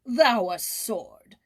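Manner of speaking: haughty royal scorn; scornful king. Acted. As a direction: sneer it down at him. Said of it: "the king laughed him to scorn".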